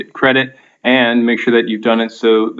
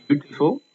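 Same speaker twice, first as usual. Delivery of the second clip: beautiful with the first syllable leaned on; first-syllable stress on beautiful; stress on BEAU; 3 semitones higher than usual. Shown as a demonstration. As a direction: say beautiful, BEAU-ti-ful, hitting the start. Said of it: "stressed at the beginning".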